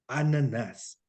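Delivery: Brazilian "Ananás", last vowel drawn out and falling; Brazilian singsong. Shown as a demonstration. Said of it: "los brasileños, sobre todo". ("Ananás" con cantadito brasileño)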